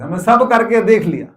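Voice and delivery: emphatic repeat. Shown as a demonstration. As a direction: punch सब and say it firmly. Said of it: "अभी हम व्यस्त में यही बात कर रहे थे".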